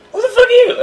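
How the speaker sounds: put-on shocked teenager snapping at a stranger; incredulous retort. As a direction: fling it back in stunned outrage. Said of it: "I'm like".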